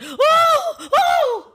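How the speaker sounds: high, loud yelping ooh; shocked ooh squealed loud and high. Retold or imitated. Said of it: "I literally yelped".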